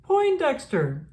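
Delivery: shouting for the lost penguins; yelling names. Acted. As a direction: call out frantically across the yard. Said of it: "yelled Captain Turbot".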